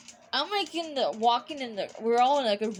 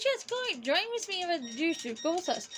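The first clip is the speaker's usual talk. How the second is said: excited invitation; 6 semitones higher, 3 dB quieter, faster than usual. Retold or imitated. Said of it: "I said".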